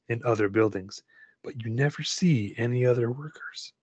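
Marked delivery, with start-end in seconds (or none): 1.4-3.7 hushed whisper. A narrator's reading. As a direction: breathe it out in a whisper. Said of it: "narration drops to whisper".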